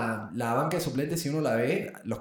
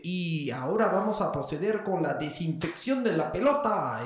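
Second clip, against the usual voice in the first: formal referee announcement, drawn out and higher; árbitro anunciando; 4 semitones higher, slower than usual. Acted. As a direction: announce it like a mock stadium official. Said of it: "el árbitro y dice". (árbitro anunciando con solemnidad exagerada)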